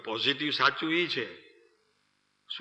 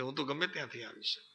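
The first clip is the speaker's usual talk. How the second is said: quick mock aside; 11 dB quieter, faster than usual. Voicing the man inside.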